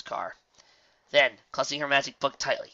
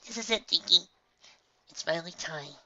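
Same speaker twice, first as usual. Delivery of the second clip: gentle mother voice; 6 semitones higher than usual. A narrator's reading. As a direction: voice the kind mother mare. Ditsy's voice in story.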